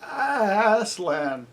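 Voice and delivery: high, drawn-out, quavering address; shaken voice. A narrator's reading. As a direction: stammer out the name, shaken. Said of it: "said Bree in a shaken voice".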